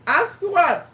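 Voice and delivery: usual speaking voice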